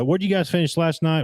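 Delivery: ordinary talk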